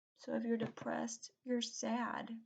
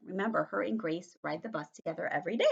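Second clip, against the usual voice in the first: normal talking voice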